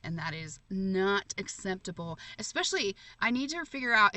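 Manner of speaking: usual speaking voice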